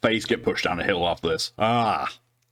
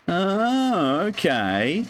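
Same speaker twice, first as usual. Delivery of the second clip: normal talking voice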